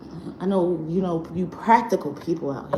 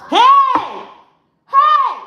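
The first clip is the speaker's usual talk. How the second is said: loud, high yell across the house; yelling to her son; 8 dB louder than usual. Real, not acted. Mother yelling across the house at her son. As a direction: call him off sharply from another room.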